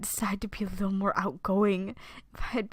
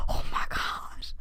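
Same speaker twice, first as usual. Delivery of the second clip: shocked whisper; slower than usual. Real, not acted.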